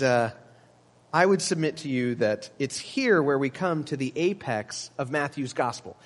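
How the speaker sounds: normal talking voice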